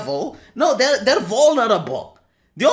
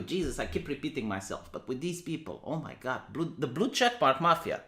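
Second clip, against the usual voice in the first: weary aside; 8 semitones lower, 11 dB quieter than usual. Real, not acted. Weary aside under breath.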